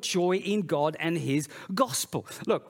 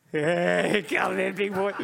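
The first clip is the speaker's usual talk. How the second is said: playful affectionate invitation with a laugh; warm welcoming call; 3 dB louder than usual. Shown as a demonstration. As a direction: welcome him in like a beaming father. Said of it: "if I use exactly the same words".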